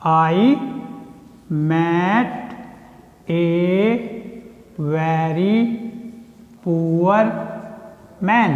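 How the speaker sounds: each word alone with long gaps; slow dictation, one word at a time; a teacher dictating so pupils can write. Shown as a demonstration.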